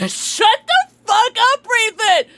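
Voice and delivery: high, loud, drawn-out shout through laughter; playful yell. Acted. Streamer jokingly telling a moderator off, playful yell.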